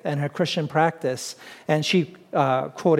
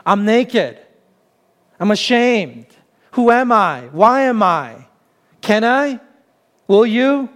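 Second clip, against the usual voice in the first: acted self-consciousness; 4 semitones higher, 3 dB louder than usual. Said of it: "Self-consciousness".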